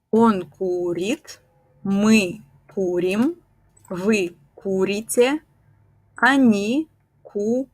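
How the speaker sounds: slow, careful conjugation; slow drill. Shown as a demonstration. Teacher repeats the forms slowly for students. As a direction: read each form slowly. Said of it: "slow conjugation drill".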